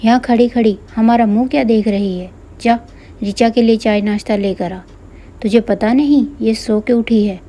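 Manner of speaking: plain reading voice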